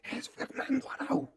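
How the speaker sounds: coro de murmullos algo agudo; multitud en quedito. Retold or imitated. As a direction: como dos mil personas bajito. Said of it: "dizque en quedito, pero son dos mil personas". (la gente lo reconoce en quedito)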